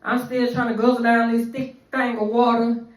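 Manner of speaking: ordinary talk, nothing marked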